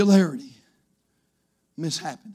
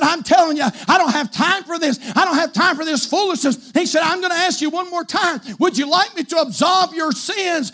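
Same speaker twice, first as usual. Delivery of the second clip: aggravated priest; 8 semitones higher than usual. Retold or imitated. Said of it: "he got aggravated".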